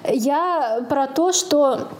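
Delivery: ordinary talk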